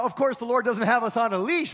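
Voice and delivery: usual speaking voice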